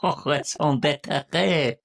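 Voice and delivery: high, slow mock-French drawl; awful French accent. Teasing the co-host for a fancy French phrase, awful French accent. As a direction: tease with a hammed-up French accent. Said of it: "awful French accent".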